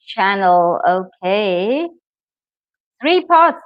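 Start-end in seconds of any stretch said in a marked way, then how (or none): 3.0-3.6 bright, louder, higher announcement of the answer; excited reveal. Real, not acted. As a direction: call it out like a quiz host.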